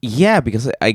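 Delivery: ordinary delivery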